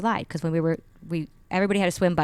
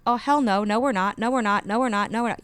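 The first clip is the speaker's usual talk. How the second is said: higher-pitched rapid-fire retold refusals; panicky refusal; 5 semitones higher, 4 dB louder than usual. Conscious mind arguing with the body about jumping, refusing. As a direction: repeat the no insistently, pitched up. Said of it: "my conscious self was like".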